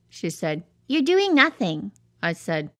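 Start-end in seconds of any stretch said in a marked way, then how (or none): 0.9-1.9 wife voice. Retold or imitated. Tornado voicing his nagging wife.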